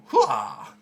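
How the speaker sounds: high, loud attack yell; battle cry. Acted. Villain jumps up to attack, battle cry. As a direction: let out a fighter's charging shout.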